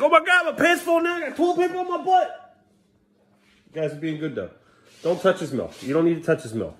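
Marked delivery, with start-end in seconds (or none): 0.0-2.4 high-pitched comic panic; mock panicked dad. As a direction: wail it high, hamming up the panic. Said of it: "Dad be running out there like".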